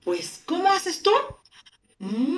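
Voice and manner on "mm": "Mm" as a long hum; pronunciación de la M. Shown as a demonstration. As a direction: hold "Mm" out with no vowel. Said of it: "se pronuncia así". ("Mm" alargado, sin vocal)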